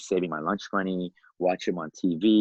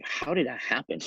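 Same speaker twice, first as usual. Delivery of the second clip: higher-pitched mock disbelief; retold amazement; 6 semitones higher, 4 dB quieter than usual. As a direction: wonder aloud, pitch lifted. Retold disbelief at unlikely event.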